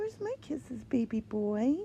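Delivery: usual speaking voice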